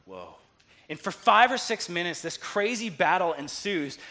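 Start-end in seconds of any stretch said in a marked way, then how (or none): 0.1-0.4 low, soft stunned murmur; understated, low-voiced wonder; hushed amazement. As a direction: let it out quietly in awe. Dan's stunned reaction, retold.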